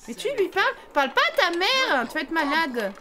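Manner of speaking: high, loud teasing scold; hammed-up parental telling-off; mock scolding. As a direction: tell them off, half joking.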